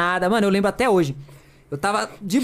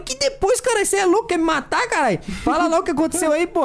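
much higher, rushed and urgent; panicked outburst; 10 semitones higher than usual. Retold or imitated. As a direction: demand an answer in a panic, pitch flying up. Panicked reply to friend, retold. (para o amigo que sumiu: pergunta desesperada e aguda)

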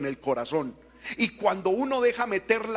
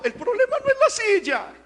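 laughing through the words; 4 dB louder than usual. Real, not acted. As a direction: laugh while making the point. Laughs at own imitation. (riendo mientras habla)

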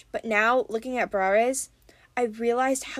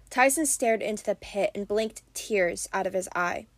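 plain reading voice

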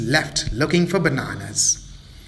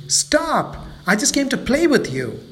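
upset protest; 9 semitones higher than usual. Acted. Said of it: "Mabel became even more angry".

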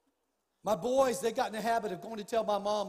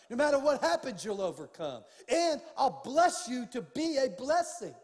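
preaching at full force; 3 semitones higher, 7 dB louder than usual. Acted. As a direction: proclaim it to the congregation like a promise from above. Preaching God's promise loudly.

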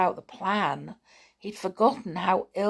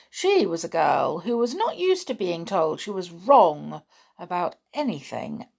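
usual speaking voice

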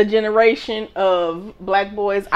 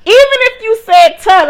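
indignant outburst; 12 semitones higher, 8 dB louder than usual. Real, not acted. Indignant over her name.

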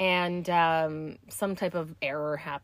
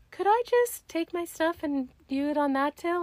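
high, sweet polite asking; overly polite ask to switch tills; mock-sweet request; 10 semitones higher than usual. Finally asks the cashier.

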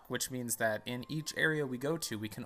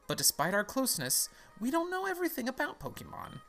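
high, lilting lecturer voice; professor character; 5 semitones higher than usual. Acted. Professor introducing the world of Pokemon, put-on high voice. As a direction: explain grandly in a put-on higher voice. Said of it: "I need a voice for this guy".

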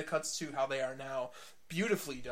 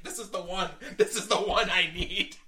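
mock-upset complaint, building louder; retold upset; 3 semitones higher, 8 dB louder than usual. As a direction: imitate a friend's upset, laughing through it. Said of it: "she was really upset".

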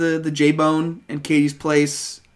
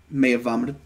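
ordinary talk

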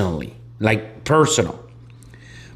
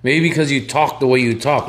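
usual speaking voice

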